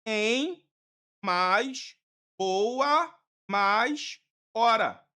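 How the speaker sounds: boa mais hora drawn out very slow, word by word; slowly split into parts. Shown as a demonstration. Teacher slowly breaking embora into its parts. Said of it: "quer dizer que é".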